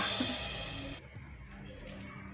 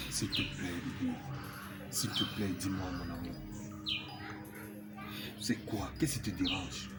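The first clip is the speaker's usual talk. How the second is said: low, soft pleading; tender coaxing; 10 semitones lower, 9 dB quieter than usual. Acted. Man pleading with his crying sweetheart to talk, tenderly. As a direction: gently coax a loved one to open up.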